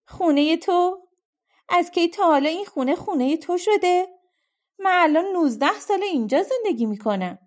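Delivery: furious retort. Acted. Woman defending her home to Rip, outraged high retort. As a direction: spit the words back at him, outraged. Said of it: "از شدت ناراحتی تف از دهانش بیرون میپرید".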